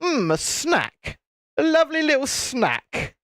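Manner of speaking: high, savouring hungry gator; gator's voice. Acted. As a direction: play a gator relishing its find. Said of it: "it would just look at you and go".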